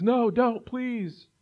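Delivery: high, pleading cry of a man in debt; begging servant. Retold or imitated. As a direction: beg for mercy, voice jumping up. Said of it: "And he begs and pleads".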